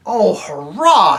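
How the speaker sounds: hurrah. A narrator's reading. Boy cheers at going north.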